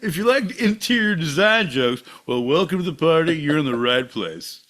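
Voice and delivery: loud, high, laughing crowd patter; hack stand-up comic. Acted. Comic welcomes folks who like interior design jokes, loud showman voice. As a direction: belt it out to the room, cracking up.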